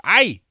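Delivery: high, loud yelp of "Ay!"; startled "Ay!". Retold or imitated. Man hit by a shopping cart yelps "Ay!" loudly. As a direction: yelp "Ay!" like someone bumped by a cart. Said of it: "When you hear someone go".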